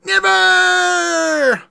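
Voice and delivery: high, loud, long-held defiant scream. Acted. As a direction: scream it and stretch the last vowel. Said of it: "I can't do the scream again".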